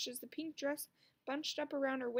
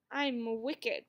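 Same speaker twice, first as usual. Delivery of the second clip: a louder, mock-wicked witch cry; proud little witch, louder; 5 dB louder than usual. A narrator's reading. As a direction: crow it out like a playground witch. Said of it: "cried Daisy".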